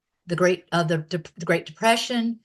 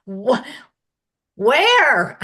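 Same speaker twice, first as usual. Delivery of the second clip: surprised; 7 semitones higher, 9 dB louder than usual. Retold or imitated. Astonished reply, retold with laughter.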